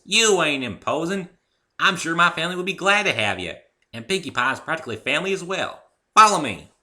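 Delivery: hearty farm mare welcoming a friend; Applejack's voice. Acted. Applejack invites them.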